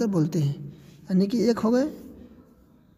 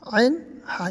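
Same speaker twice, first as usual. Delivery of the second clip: usual speaking voice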